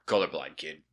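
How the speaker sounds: low, lightly voiced deadpan dog; deep-voiced commercial dog. Quoting an old commercial at his friend, lightly deep dog voice. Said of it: "it would be this very deep voice".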